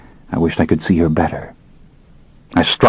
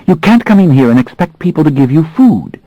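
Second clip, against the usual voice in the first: put-on young woman's higher, uneasy anger; trembling anger; 9 semitones higher, 4 dB louder than usual. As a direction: hold him back, upset and angry. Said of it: "trembling slightly in her anger and discomfort".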